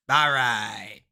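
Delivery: loud, drawn-out streamer catchphrase; Jinxie catchphrase. Acted. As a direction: belt out an imitation of a streamer's drawn-out sign-on. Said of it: "If any of you guys watch Jinxie".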